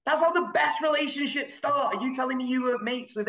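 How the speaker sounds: high emphatic declaration; passionate retort. Real, not acted. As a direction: insist with rising passion. Passionate rebuttal.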